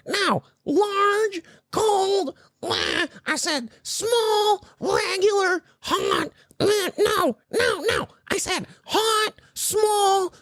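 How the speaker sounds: very high mocking squawk; exaggerated squeaky impression of a rude customer. Line cutter ordering at the drive-thru, rude high-pitched mock voice. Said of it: "she was being rude to".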